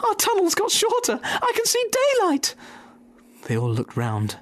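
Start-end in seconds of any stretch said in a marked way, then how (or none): 0.1-2.5 very high excited cub voice; a cub spotting daylight, thrilled; small fox. Acted. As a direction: squeal it like an amazed little cub. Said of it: "said one of the small foxes".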